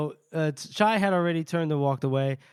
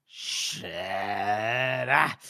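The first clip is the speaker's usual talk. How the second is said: low drawn-out groaned curse; reluctant groan; 7 semitones lower than usual. Acted. Reluctant character gives in, swearing.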